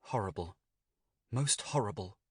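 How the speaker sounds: rising, faltering horror; a touch of shaken horror; faltering, trembling. A narrator's reading. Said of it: "faltered the trembling Walter".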